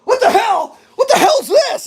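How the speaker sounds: high, loud shocked yell; high startled shout of disgust; shocked outburst. Retold or imitated. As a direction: recoil in shock at the sight. Shocked at tick-covered dog.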